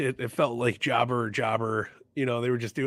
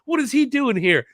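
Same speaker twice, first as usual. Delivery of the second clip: high, loud panicked announcer voice; shocked announcers; 13 semitones higher, 6 dB louder than usual. Retold or imitated. Imitating the announcers' panicked shock, high and loud. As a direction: sell total shock at the intruder. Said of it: "everybody just playing".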